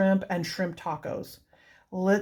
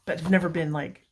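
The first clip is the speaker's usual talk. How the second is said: normal talking voice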